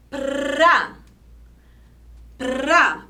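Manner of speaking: PRA with a long rolled R; joined trilled syllable. Shown as a demonstration. Teacher showing R stays in the syllable, trilled. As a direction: roll P and R together into "Pra". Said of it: "Ficou juntinho na mesma sílaba".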